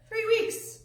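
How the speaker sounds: squeaky high "Three weeks"; high-pitched disbelief. Real, not acted. Preacher marvels at the long wait, high-pitched repeat.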